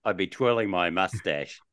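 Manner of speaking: usual speaking voice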